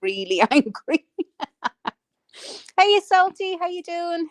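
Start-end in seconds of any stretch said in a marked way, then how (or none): 2.8-4.3 high cheery hello to a viewer; excited greeting. Real, not acted. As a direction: call out a bright welcome.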